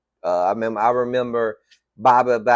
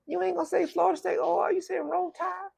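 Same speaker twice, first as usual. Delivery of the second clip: incredulous; 7 dB quieter than usual. Retold or imitated. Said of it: "I'm like".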